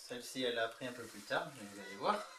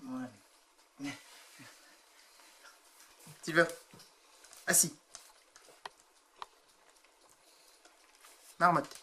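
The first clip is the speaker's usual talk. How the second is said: higher sing-song commands to pets; talking to a cat; 3 dB quieter than usual. Real, not acted. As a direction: coax and praise the cat. Training cats with treats.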